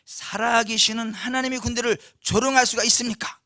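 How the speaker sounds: loud, indignant rhetorical questions; bold young challenger. Acted. David challenging the soldiers about Goliath, loud and indignant. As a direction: call out a defiant challenge. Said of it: "이렇게 이야기를 했죠".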